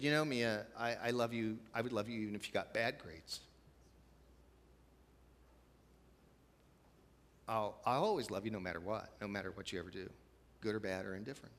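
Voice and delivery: soft, gentle reassurance to a daughter; a father quietly reassuring; tender reassurance. Retold or imitated. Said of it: "I just said to her".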